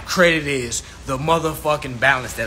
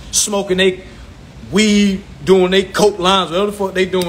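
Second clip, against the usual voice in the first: ordinary delivery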